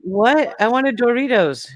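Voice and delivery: high-pitched mock disbelief; acted-out indignant confusion; baffled protest. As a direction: protest as if handed the wrong thing. Acting out confusion over chips.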